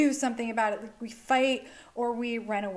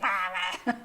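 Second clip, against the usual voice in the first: short laugh; 3 semitones lower than usual. Real, not acted. Amused at her binge.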